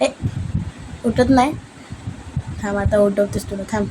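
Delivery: ordinary talk, nothing marked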